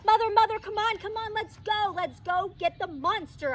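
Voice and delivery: put-on high breathless child holler; excited hollering. A narrator's reading. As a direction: hurry mother to get the monster. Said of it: "I hollered".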